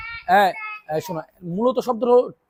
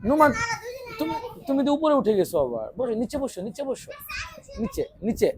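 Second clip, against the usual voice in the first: quiet aside; 4 semitones higher, 9 dB quieter, faster than usual. Real, not acted. Teacher quietly telling a pupil to sit back down. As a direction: hush it to a single pupil.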